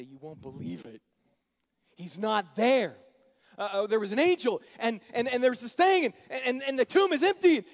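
loud, high, tumbling stammer; breathless excitement. Acted. The women rushing back to the apostles, breathless and excited. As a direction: blurt it out overwhelmed with news. Women report the empty tomb.

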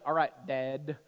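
low Duck Dynasty drawl, imitating a son; imitated Duck Dynasty drawl. Son answers dad after too much Duck Dynasty, mock drawl. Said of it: "we're all talking slower".

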